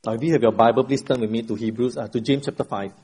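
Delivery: ordinary talk, nothing marked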